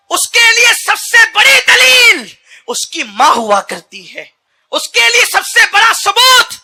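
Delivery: high, loud roaring sermon cry; preaching at full force. Acted. Preacher rousing the congregation, roaring high and loud. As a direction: thunder it out to the crowd.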